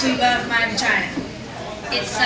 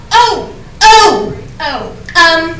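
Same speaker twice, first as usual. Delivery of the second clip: high, loud, startled ohs, then awkward declining; flustered and hesitant, politely fending him off; flustered refusal; 4 semitones higher, 4 dB louder, slower than usual. Acted. Flustered refusal of being looked at.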